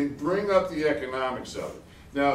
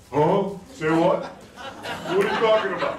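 louder, higher mock bafflement; 4 semitones higher, 4 dB louder than usual. Retelling a baffled audience member, mock bafflement. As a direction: play the baffled listener.

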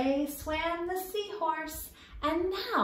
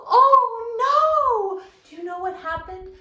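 loud, much higher gasp and oh no; mock shock; 12 semitones higher, 9 dB louder than usual. Teacher gasps at the children as the fish is eaten. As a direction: play up the shock for the kids.

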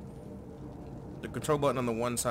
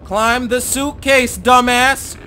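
slower, even louder shout; louder angry command; 9 semitones higher, 14 dB louder, much slower than usual. Real, not acted. Player repeating the order, louder and angrier. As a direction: push it harder the second time.

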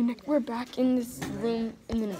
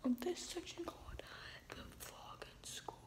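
whispering; 4 semitones higher, 15 dB quieter than usual. Real, not acted. Whispering sign-off in class.

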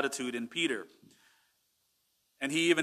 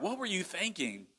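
higher, softer mock disbelief; incredulous question; 5 semitones higher, 8 dB quieter than usual. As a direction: question it like a baffled armchair fan. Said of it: "you're looking and you're like".

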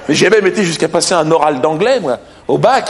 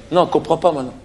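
ordinary talk

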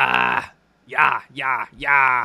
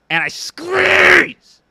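high, slow, straining yell; squeezing shout; 9 dB louder, much slower than usual. Retold or imitated. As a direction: strain out the word like crushing it. Retelling crushing a mosquito.

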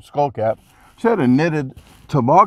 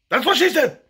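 high-pitched joking quip; that's what she said joke. Retold or imitated. Joking quip to people talking off the wall. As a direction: squeak out the punchline like a sitcom gag. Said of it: "I'm gonna say".

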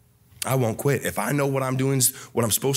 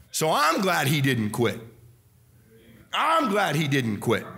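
ordinary talk

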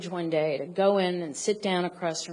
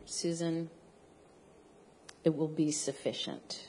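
imitated calm editor; 3 semitones lower, 7 dB quieter than usual. Editor calmly answering a young writer's pay question, lower and softer. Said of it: "very calmly and he said".

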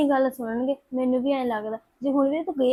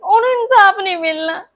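crying; 3 semitones higher, 4 dB louder than usual. Acted. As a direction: voice breaking into tears, slightly louder. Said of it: "ਤੂੰ ਰੋਇਆ ਨਾ ਕਰ".

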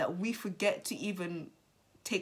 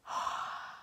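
breathy tearful sigh; 6 dB quieter than usual. Real, not acted. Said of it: "Guys, I'm so sorry about that".

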